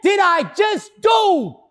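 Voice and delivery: high, loud, slow cry of disbelief; horrified shout. Retold or imitated. Man realizes he just relapsed in his car, shouting. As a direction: yell it at yourself in shock. Shock after drinking four bottles.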